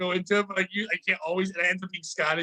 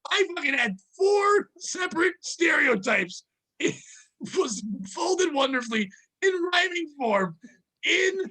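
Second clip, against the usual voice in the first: laughing indignation; 9 semitones higher than usual. Real, not acted.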